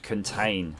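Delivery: normal talking voice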